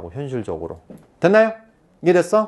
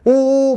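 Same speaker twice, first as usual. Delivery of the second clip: high, loud, drawn-out coo; acted gasp of delight; delighted "ooh"; 9 semitones higher, 6 dB louder than usual. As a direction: imitate cooing at an adorable puppy. Imitates reaction to a fluffy puppy.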